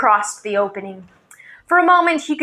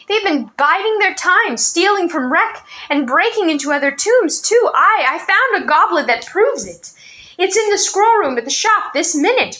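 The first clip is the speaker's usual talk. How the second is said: panted out story; 7 semitones higher than usual. A narrator's reading. Boy panting out his story to the old man. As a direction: pant it out in a hurry. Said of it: "Ranifer panted out his story".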